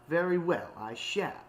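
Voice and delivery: curt, stiffly polite parting; huffy. A narrator's reading. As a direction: accept with wounded dignity. Octavia leaves in a huff.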